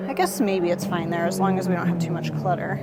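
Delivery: ordinary delivery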